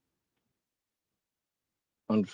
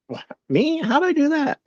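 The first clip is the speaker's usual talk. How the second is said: very high, squeaky innocent "Me?"; mock innocent. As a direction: play the wide-eyed innocent in a tiny high voice. Feigned innocence at chat.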